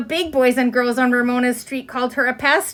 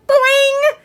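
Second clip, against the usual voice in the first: high, loud springy boing; spring the word up like a released curl; playful boing; 7 dB louder than usual. Acted. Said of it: "she cried".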